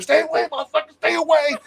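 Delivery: retelling yelling at a possum, high mock panic; panicked yell. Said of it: "I was yelling at him".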